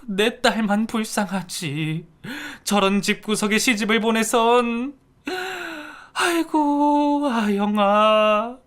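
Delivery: slow, high drawn-out wailing lament; keen over your dead daughter. Acted. Mother wailing on the floor for her daughter. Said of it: "바닥에 쓰러져 통곡을 했죠".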